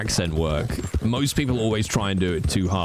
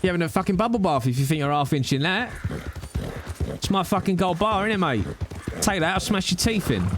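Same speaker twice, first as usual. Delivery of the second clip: higher-pitched threatening Cockney banter; 7 semitones higher than usual. Pretend. Cockney warning someone off a gold bar, mock threatening. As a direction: play a mouthy Cockney squaring up. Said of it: "Can you hear my Cockney?".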